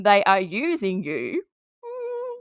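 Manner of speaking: ordinary delivery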